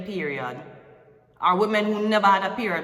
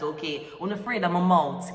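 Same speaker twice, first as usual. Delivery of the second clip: normal talking voice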